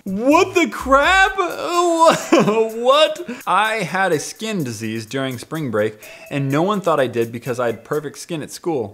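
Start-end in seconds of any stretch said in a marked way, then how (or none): 0.0-3.4 high-pitched, drawn-out disbelief, breaking into laughter; incredulous outburst. Real, not acted. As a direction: react in baffled, squeaky amazement and laugh. Baffled reaction to story read aloud.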